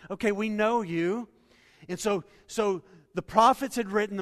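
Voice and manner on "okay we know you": raised and louder, a dismissive shrug of a line; mock-dismissive crowd saying "we know you". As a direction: brush him off like an old neighbour. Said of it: "the people are like".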